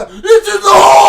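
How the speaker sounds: loud high shout at the green; golf crowd yell. Retold or imitated. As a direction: bellow it like a fan at the tee. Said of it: "were doing the".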